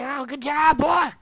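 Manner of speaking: hammed-up congratulations; cheering crowd. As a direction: cheer like proud onlookers. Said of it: "they were all telling me".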